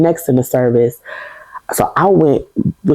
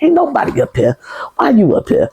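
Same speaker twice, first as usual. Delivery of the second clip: high, sing-song nosy churchgoer; imitating a nosy church member calling out, pitched way up; nosy church lady; 13 semitones higher than usual. Church members finding her upstairs.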